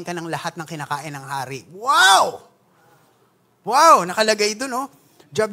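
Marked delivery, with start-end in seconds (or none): 1.7-4.0 loud, high ironic wow; mock amazement. Preacher mocking the cupbearer job perks, loud mock wow. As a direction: shout wow as if dazzled, tongue in cheek.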